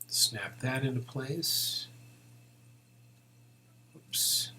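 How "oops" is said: ordinary talk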